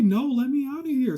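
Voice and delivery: very loud, high-pitched protest; shouted demand. Acted. Inmate demanding release from the cell, shouting. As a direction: holler it like you want the door opened.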